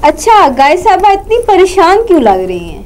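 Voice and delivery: normal talking voice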